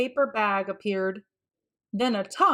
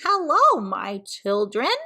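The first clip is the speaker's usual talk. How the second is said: croaking grandpa; 5 dB louder than usual. Acted. Archie as grandpa greets the kids, croaking. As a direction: croak it like an old man. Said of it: "Archie croaked".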